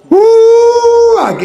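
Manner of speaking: a high, loud mock "uh" of disbelief; scoffing at the idea with a loud, high "uh"; mock gasp. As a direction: toss out a sarcastic surprised yelp. Mocking the protestants' boldness.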